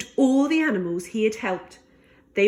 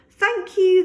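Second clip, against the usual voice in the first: much higher, louder squeak; squeaky thanks; 10 semitones higher, 7 dB louder than usual. Acted. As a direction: squeak it like tiny mice. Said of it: "they squeaked".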